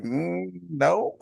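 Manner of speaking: high-pitched mock "no"; imitated hesitant refusal. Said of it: "they're like".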